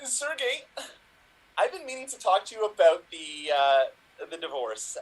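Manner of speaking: halting, choked-up confession; emotional divorce talk. Acted. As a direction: struggle to get the words out. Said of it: "[name], new emotional choice".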